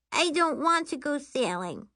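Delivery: put-on high, whiny child voice; high-pitched childish refusal; refusing little girl. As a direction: balk like a small kid.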